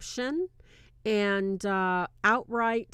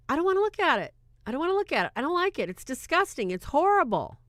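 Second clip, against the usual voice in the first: higher, upset complaint; raised pitch disgusted whine; 7 semitones higher, much faster than usual. Retold or imitated. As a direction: whine that you can't stand it.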